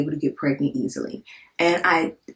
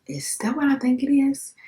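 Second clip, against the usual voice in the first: stunned disbelief; 9 semitones higher than usual. Retold or imitated. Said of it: "And I was like".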